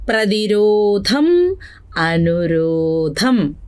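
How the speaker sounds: each word drawn out slowly, one by one; slow drilled recital. Shown as a demonstration. Teacher drawing out each word slowly so students repeat. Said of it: "ഒന്നുകൂടെ നമുക്ക് നോക്കാം".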